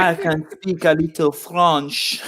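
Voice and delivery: slow, hammed-up French accent; comic French accent. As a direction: put on a bad French accent, drawn out. Mimicking Crabtree's bad French accent.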